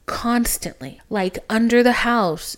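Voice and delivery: normal talking voice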